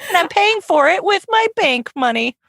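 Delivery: lightly hammed-up buddy chatter; playful pretend voice. Continues pretend monkey scene.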